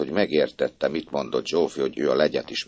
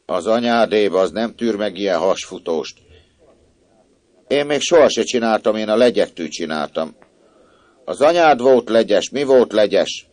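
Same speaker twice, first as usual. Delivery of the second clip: mocking scolding; 5 semitones higher, 5 dB louder than usual. A narrator's reading. Maid mocks the girl.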